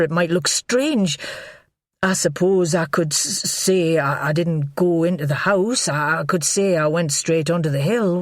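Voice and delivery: stammering, rambling nervous man; panicky man. A narrator's reading. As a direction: babble out the worry.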